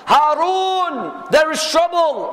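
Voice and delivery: high, urgent calling out; alarmed report. Retold or imitated. Guards bring alarming news to Harun.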